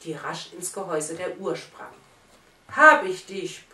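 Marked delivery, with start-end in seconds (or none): 2.7-3.7 a loud triumphant roar; roaring shout. Acted. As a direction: bellow it as you pounce on the nut. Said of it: "brüllte der Nussknacker".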